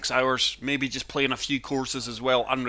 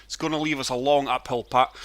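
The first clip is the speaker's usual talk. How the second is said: usual speaking voice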